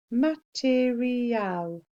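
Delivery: Material stretched slow; slow careful Material; sounded out slowly. Shown as a demonstration. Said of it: "Mat-er-ial".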